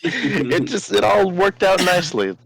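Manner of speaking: high-pitched, amused, just out of laughter; laughing. Real, not acted. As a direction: say it with laughter lifting the voice. Laughing at own rude name.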